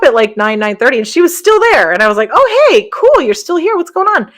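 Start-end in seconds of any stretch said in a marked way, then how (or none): 2.3-4.3 high, cheerful hey; cheerful surprise. Retold or imitated. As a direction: sound pleasantly surprised she's still there. Greeting aunt still in room.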